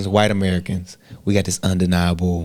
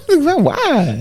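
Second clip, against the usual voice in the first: laughing disbelief. Real, not acted. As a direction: laugh out the question. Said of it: "laughing incredulous retelling".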